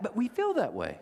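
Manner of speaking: ordinary talk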